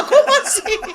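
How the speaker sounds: high, loud, laughing disbelief; surprised laugh. Retold or imitated. Friend asked if she sang in Hebrew, answering with surprised laughter.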